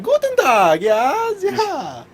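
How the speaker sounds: put-on high, loud mad-doctor German accent; evil German doctor. Haunted-house doctor greeting guests, shrill evil German accent. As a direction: shriek it as a fake German doctor. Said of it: "like, the evil doctor thing from".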